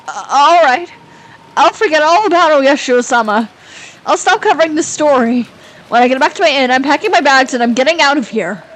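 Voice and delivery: loud, high-pitched outburst; agitated surrender. Acted. Cornered writer quitting the story, agitated and loud. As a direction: blurt it out, giving up in a panic.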